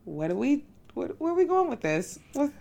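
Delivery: ordinary talk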